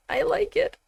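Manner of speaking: high, giddy, swooning squeal. Real, not acted. Gamer swooning over a game character's voice, giddy squeal. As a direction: gush it out like a smitten fan. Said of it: "Are you serious, [name]? Get a hold of yourself".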